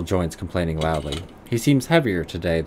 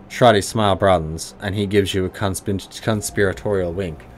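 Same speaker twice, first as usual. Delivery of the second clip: usual speaking voice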